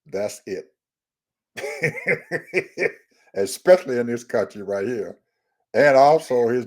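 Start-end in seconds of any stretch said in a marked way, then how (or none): 1.6-2.9 short high-pitched giggle; laughing. Real, not acted. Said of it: "I'm, I'm laughing".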